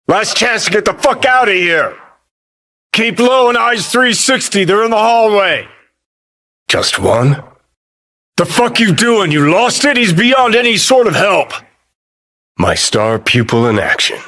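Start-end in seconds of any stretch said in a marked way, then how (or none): 0.1-5.7 high, urgent warning over gunfire; urgent combat shout. Acted. 8.4-11.5 high-pitched, exasperated yelling; alarmed scolding. Acted. Berating a companion in action.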